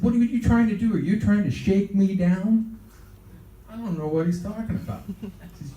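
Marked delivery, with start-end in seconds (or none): 0.0-2.8 raised, pressing mock-angry questions; higher, louder and suspicious; retold accusation. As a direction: act out an indignant accusation. Acted accusation by [name].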